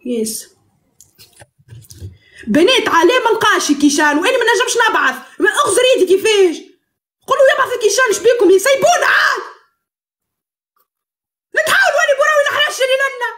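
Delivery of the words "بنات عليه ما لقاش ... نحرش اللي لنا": high, fast, half-laughing protest; worked-up complaint. Pretend. Complaining to the girls about Kishan, high playful outburst. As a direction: rattle it off high and exasperated.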